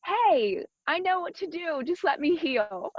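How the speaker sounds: high-pitched plea from a talking body; the body speaking up. Acted. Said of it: "it gives the body a chance to say".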